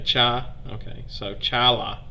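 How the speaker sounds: low, soft "cha", then "chala" with open AH; practising pronunciation. Real, not acted. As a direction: murmur "chala" to yourself to learn it. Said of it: "my attempts to enunciate all these words".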